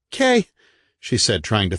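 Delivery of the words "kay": dazed woman. A narrator's reading.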